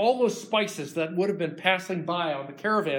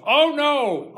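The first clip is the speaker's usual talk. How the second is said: yelped much higher and louder; startled protest; 10 semitones higher, 10 dB louder than usual. Acted. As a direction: jump as if caught out. Jacob caught, protests.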